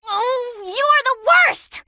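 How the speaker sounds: embarrassed outburst. Acted. Embarrassed at Felix.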